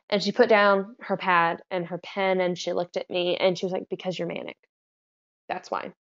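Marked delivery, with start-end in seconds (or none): 3.9-5.9 very soft, matter-of-fact reply; calm explanation. Retold or imitated. Medical student answering an angry manic patient, softly and calmly. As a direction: quote a calm clinician, hushed and measured.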